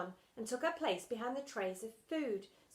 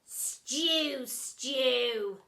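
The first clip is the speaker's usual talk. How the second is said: 1 thundered; 3 semitones higher, 7 dB louder than usual. Acted. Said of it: "thundered Splat".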